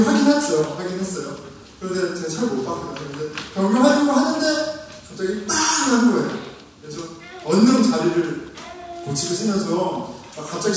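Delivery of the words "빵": imitated car horn. Startled driver recalling the honk, imitated car horn. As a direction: blare it out like a horn.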